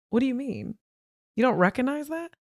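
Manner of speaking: retold probing question, lifting at the end; therapist's pointed question. Therapist questioning her client about the child's outburst, incredulous.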